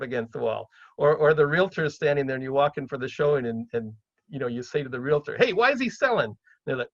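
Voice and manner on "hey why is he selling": high-pitched, blunt nosy question; tactless questioning. Acted. Buyer accosting the realtor at a showing, tactlessly blunt. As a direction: put the realtor on the spot. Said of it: "you have to be tactful about this".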